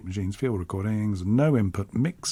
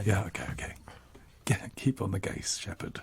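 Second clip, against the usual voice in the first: near-whisper, much softer; hushed aside; 4 semitones lower, 10 dB quieter than usual. Real, not acted. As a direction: drop to a whisper under your breath. Hushed aside before playing the track.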